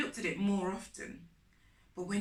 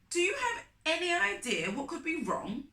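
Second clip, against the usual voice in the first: father asking; 8 semitones higher, 5 dB louder than usual. A narrator's reading. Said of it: "my father asked".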